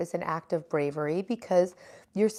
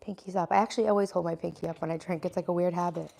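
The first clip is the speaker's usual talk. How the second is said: ordinary delivery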